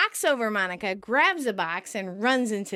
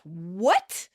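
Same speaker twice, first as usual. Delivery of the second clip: mild mock shout; a short retold outburst; 4 semitones lower than usual. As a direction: lightly imitate a shocked shout. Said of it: "Monica shouts".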